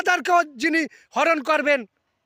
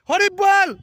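devotional call; 5 semitones higher, 4 dB louder than usual. Shown as a demonstration. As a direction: call out Hari বল loud and high. Devotional call after explanation.

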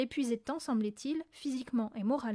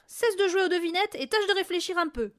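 angry scolding; 7 semitones higher, 6 dB louder than usual. A narrator's reading. Father scolding Mathilda.

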